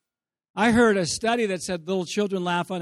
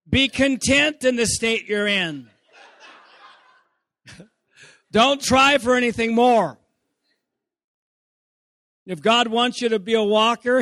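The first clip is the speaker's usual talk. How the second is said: pompous preacher; 3 semitones higher, 5 dB louder than usual. Retold or imitated. Mock preacher scolding toddler.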